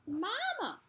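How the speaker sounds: put-on high, loud little child's voice; small child. Small child calling to his mother, high and eager. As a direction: call out like an excited small kid. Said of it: "said a small child".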